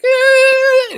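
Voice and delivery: very high, loud startled yelp; startled squawk. Retold or imitated. Bus driver jolted by the yelling, shrill startled squawk.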